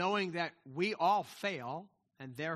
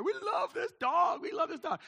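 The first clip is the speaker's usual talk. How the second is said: shouted, much higher, overjoyed; puppy love gush; 10 semitones higher, 4 dB louder than usual. Retold or imitated. The family gushes over the new puppy in a high, shouted squeal. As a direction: squeal it like the puppy just arrived.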